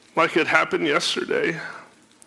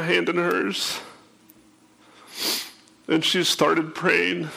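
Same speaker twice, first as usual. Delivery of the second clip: fighting tears while telling it; holding back tears; 3 semitones higher than usual. Real, not acted. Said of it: "kinda like now".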